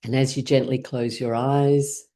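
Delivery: settled meditation-guide delivery; guided mindfulness. Shown as a demonstration. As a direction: ease them into stillness, leaving space between phrases. Leads mindfulness check-in.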